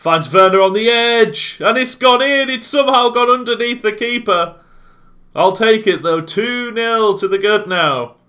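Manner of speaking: thrilled, loud and high; excited goal call. Real, not acted. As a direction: relish the lucky goal. Second goal goes in.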